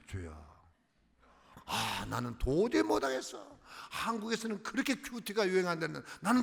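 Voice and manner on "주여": still small voice. Retold or imitated. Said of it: "세미한 음성".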